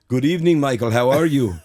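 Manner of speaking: Arnold impression. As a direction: boom out a hearty Arnold hello. Arnold answers the prank call.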